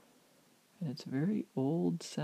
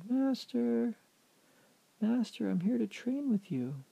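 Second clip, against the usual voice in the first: raised-pitch seeker's hail; calling out; 10 semitones higher than usual. Acted. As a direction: call for someone you can't see. Calls into shack for master.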